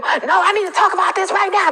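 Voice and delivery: high, loud, pushy demand; demanding toxic partner. Retold or imitated. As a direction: insist on talking now. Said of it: "Now you have toxic people".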